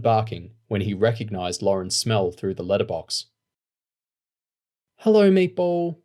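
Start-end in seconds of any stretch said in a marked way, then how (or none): 5.0-6.0 high, fond hello to the dog; fond greeting. A narrator's reading. Lauren greeting her dog fondly, high and loud. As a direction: greet the dog lovingly, much higher and louder. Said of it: "Lauren said fondly".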